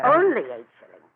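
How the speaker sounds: loud, incredulous throwing his words back; outraged repeat. Acted. Agatha to Harold over the price, loud outraged echo. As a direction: scoff at his excuse.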